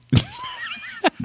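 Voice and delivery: sudden high shrieking laugh burst. Real, not acted. Host cracks up at his own breakup story, shrieking laugh. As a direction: let out a real yelp of laughter.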